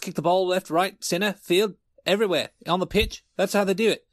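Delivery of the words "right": ordinary talk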